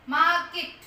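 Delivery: मार्केट said loud and clear as a model; teacher demonstrates मार्केट; model word market. Shown as a demonstration. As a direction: pronounce मार्केट crisply and loudly for the class. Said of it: "क्या होता है?".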